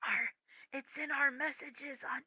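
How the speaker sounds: hushed aside; whisper. Real, not acted. DM whispering where the Discord message is, whisper. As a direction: keep it a private whisper.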